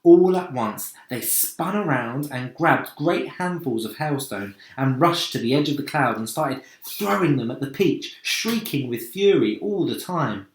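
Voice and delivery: usual speaking voice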